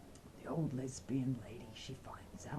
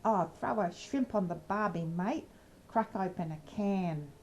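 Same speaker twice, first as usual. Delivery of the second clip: put-on Aussie slang voice, pitched up; Australian accent; 4 semitones higher, 3 dB louder than usual. Shown as a demonstration. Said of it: "to sound more Australian".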